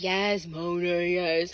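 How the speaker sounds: slow, drawn-out imitation of put-upon patience; mock weary patience. Older date answers her teasing, mock weary patience. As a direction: draw out each yes, humoring a troll.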